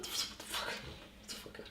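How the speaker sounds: barely voiced, whispered disbelief; stunned whisper. Real, not acted. Reacting to a friend's dark joke, stunned whisper. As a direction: whisper it under your breath.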